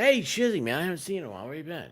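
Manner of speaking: high, loud mock fan greeting; imitating a fan spotting a friend; cheery greeting. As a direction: play an eager fan calling out.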